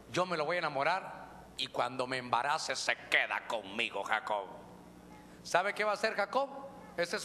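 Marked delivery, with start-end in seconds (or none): 0.1-4.5 low, sly plotting voice; lower, sly voice of a woman plotting to trap him; conniving woman scheming aloud. Acted.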